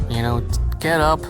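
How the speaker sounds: ordinary talk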